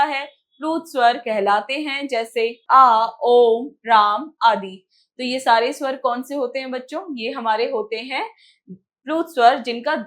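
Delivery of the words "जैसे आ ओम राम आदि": आ, ओम, राम with every vowel stretched long and slow; drawn-out pluta vowels. Shown as a demonstration. Teacher demonstrating long pluta vowels to the children, slow and stretched. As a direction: hold each vowel well past its normal length. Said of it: "दीर्घ स्वर से भी अधिक समय लगता".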